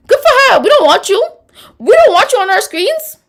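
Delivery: high, loud, gloating cheer; gleeful dismissal. Real, not acted. Host cheering a houseguest's exit, high and loud. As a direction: cheer her exit with mock glee. Reacting to a quitting player.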